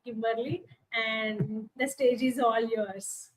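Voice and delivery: usual speaking voice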